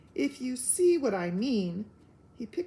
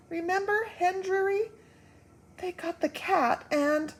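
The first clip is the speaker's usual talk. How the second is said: high, worried recollection; fearful reminder; 9 semitones higher, 3 dB louder than usual. Acted. Wife recalling a past tragedy, high fearful voice. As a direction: remind him of the danger anxiously.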